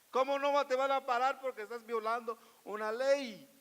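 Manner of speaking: loud, high-pitched scolding; indignant rebuke. Acted. Preacher answering a deported man's excuse, indignant rebuke.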